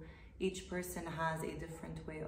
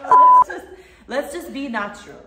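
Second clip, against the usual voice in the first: loud, high, through real laughter; laughing; 8 semitones higher, 25 dB louder than usual. Real, not acted. Host trying to restart the take, still laughing. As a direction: talk through the giggles, pitched up. Recovering from laughing fit in blooper.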